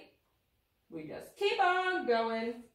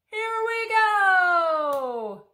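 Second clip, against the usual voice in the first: excited sled cry; 8 semitones higher, 13 dB louder, much slower than usual. Acted. Sledding in story.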